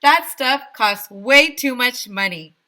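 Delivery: high, loud proclamation; mayor proclaiming loudly; loud decree. A narrator's reading. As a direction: boom out the rule. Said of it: "the mayor continued".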